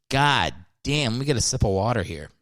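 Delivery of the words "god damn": usual speaking voice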